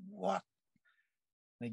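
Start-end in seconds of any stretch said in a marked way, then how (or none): 0.1-0.4 a sudden, much higher startled what; the stunned stranger blurts it out; retold shock. Stunned guy reacts to light.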